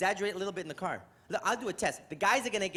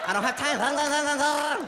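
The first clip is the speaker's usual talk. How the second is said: slow, high, loud drawn-out whine; lazy kid whine; 6 semitones higher, 6 dB louder, much slower than usual. Retold or imitated. Daughter too lazy to lick lollipop, slow whiny drawl.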